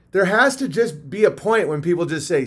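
ordinary delivery

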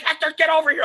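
high, urgent mock yell; high-pitched retold holler; imitated scream. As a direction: reenact screaming at a runaway dog. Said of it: "I'm screaming at this dog".

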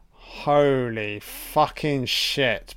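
very slow, weary and stunned; drawn-out disbelief. Real, not acted. Vlogger groaning slowly at an awful movie. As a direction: stretch every word out in disbelief.

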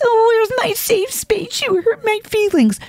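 mock a coddled kid begging for a safe space; a mother mocking oversensitive kids in a whiny, high child voice.

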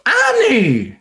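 high drawn-out call of a name; impression of Anakin. Friend mimics a Star Wars voice, high drawn call. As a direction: call the name out like the movie character. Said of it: "How the fuck does he sound?".

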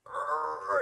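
high, loud imitated lifting grunt; mock workout strain; straining growl. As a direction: growl as if forcing up a heavy weight. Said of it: "totally straining and being".

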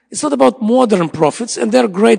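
fervent sermon challenge; preaching at full force. Acted. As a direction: press the crowd with each question. Preacher declares to congregation.

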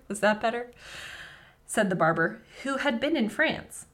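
ordinary talk

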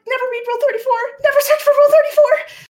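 very high, loud anguished wail; hammed-up panicked wail. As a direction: cry out in despair. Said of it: "Hasno's like".

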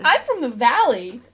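put-on loud, drawn-out Valley girl accent. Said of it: "Yeah, Valley girl".

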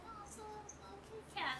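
soft high cooing at a kitty; mock baby talk to a cat. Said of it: "and everybody's like".

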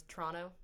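"Toronto" run together as Tronno; local pronunciation. Shown as a demonstration. Guest explains Canadian habit, "Toronto" squashed to Tronno. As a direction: drop the second t in "Toronto". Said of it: "it's the same thing as".

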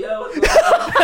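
high, loud, laughing "yo what's up"; hammed-up cheerful hello through a laugh; mock cheery greeting. Calling out to the people in the next cell, high and laughing.

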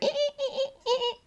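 very high imitated squeaks; squeaky noises. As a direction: squeak in short, high repeated bursts. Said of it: "make squeaky noises on their heads".

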